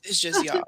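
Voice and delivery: "y'all" said short and light, no stress on the L; quick "y'all" with the L barely there; "y'all" as one swallowed syllable, no L push; correct y'all. Shown as a demonstration. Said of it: "Don't put no emphasis on the L's".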